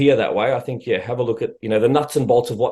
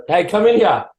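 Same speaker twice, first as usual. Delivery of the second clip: raised, higher-pitched call; 9 semitones higher, 4 dB louder than usual. Real, not acted. Host summoning wife from the other room, calling out. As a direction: call through to another room.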